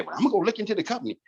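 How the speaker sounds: mock hurried objection; skeptical prospect. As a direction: imitate a customer's excuse in a rush. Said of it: "and you say".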